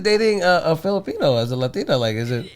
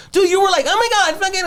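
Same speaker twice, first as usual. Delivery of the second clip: much higher squeaky mock of his girlfriend; mocking her voice; faster than usual. Boyfriend imitating his girlfriend to annoy her, high mocking voice. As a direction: tease her by aping her voice way up high. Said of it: "Don't fucking do my voice".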